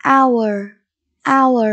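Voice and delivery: "Hour" said with no H sound; silent H. Shown as a demonstration. Teacher showing pupils the word, "Hour" with silent H. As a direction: say "Hour" starting straight on the vowel, like "our". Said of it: "chữ hờ chúng ta không đọc".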